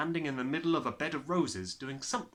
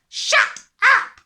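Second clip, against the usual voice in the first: loud, much higher furious shout; 20 dB louder than usual. Acted. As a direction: scream it at the child. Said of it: "the father shouted".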